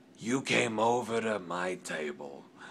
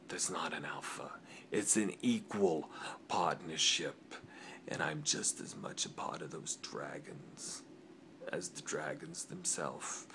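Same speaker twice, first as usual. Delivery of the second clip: menacing whisper; 3 semitones lower, 8 dB quieter than usual. Acted. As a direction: drop to a hushed, secretive hiss. Confiding menace to rival.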